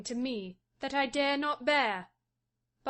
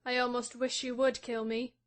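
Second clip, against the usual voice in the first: gloomy bitterness; 11 semitones higher, 6 dB quieter than usual. A narrator's reading. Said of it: "said she with gloomy bitterness".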